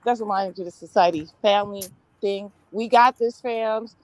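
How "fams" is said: normal talking voice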